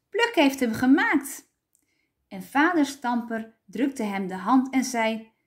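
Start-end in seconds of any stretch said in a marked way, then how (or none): 0.1-1.5 high, excited announcement; raised, triumphant little voice; children shouting. A narrator's reading. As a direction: cry it out like thrilled kids. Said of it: "schreeuwden de stampertjes".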